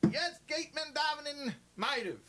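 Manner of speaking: high, loud announcing voice; mock sexton announcing. Sexton announcing evening prayer to the visitor, hammed-up. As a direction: call it out like a shul sexton. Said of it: "he's like".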